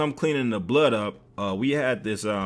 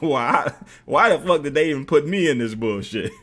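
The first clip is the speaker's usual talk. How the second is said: ordinary talk, nothing marked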